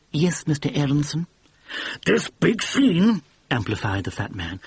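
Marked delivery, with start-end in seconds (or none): 0.1-1.3 put-on prim, ultra-refined English accent; polite, refined English accent correcting the boss; refined English accent, lightly done. A narrator's reading. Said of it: "young man with an ultra-refined accent". 2.0-3.2 high, pushy Brooklyn-ish cigar man; brash producer. A narrator's reading. Fat producer voice.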